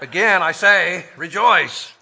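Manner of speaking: loud, insistent proclamation of rejoice; emphatic. Shown as a demonstration. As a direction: hammer home rejoice with a big loud push. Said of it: "just to make it even more emphatic".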